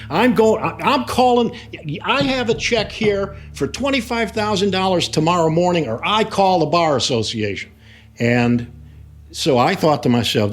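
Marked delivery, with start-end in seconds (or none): 0.1-7.7 imitated high-pitched angry threat; John getting nasty. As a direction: play a furious client making threats, pitched high, easing down at the end. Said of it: "getting nasty beyond belief".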